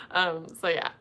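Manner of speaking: ordinary talk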